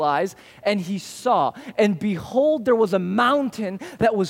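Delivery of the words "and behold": ordinary talk, nothing marked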